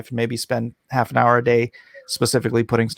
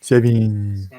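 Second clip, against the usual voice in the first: goofy countdown. Pretend. Silly auction countdown, varied funny voices.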